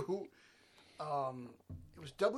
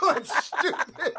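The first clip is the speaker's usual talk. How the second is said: slow words through laughter; laughing; 11 semitones higher, 11 dB louder, much slower than usual. Real, not acted. Laughing at partner's neck.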